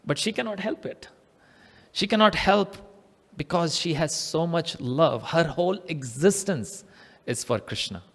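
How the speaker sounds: soft, hushed devotion; quietly heartfelt storytelling; tender narration. A narrator's reading. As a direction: let it sink to a gentle hush. Narrator softens over helpless love.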